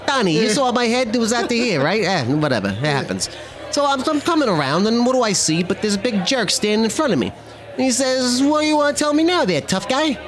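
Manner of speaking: New Jersey wiseguy telling a cocky tale; animated tough-guy storyteller with a Jersey accent; Joe Pesci. Acted. Wiseguy recounting a run-in with a cop, Pesci-style bravado. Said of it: "You do that accent so well".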